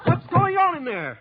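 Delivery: high shout, slow and urgent; alarmed shout outside her room; shouting through door. Acted. Calling through the locked door.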